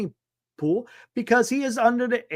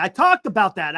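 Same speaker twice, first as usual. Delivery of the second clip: ordinary delivery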